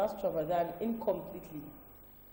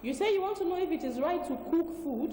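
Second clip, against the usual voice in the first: raised pitch, repeated clearly for caller; to someone hard of hearing; 8 semitones higher than usual. Real, not acted. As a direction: say it again higher for a bad phone line. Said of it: "Uh, hello?".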